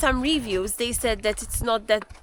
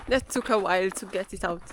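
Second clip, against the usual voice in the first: ordinary delivery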